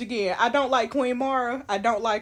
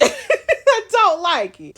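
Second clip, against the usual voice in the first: slow, laughing, louder and higher drawl; laughing repeat; 7 semitones higher, 9 dB louder, much slower than usual. Real, not acted. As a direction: stretch it out through the laugh.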